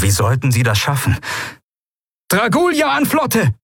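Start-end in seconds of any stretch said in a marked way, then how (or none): 2.3-3.5 high-pitched urgent radio hail; much higher, forceful call. A narrator's reading. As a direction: call the fleet from the battlefield. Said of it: "rief er".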